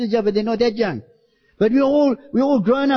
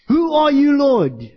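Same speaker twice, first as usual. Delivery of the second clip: higher and louder, a pleading cry; Saul crying out; 5 semitones higher, 3 dB louder, slower than usual. Retold or imitated. Preacher voicing Saul crying out to the Lord, louder and pleading. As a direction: call it out toward heaven, then drop on Lord. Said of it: "when he cried out and he said".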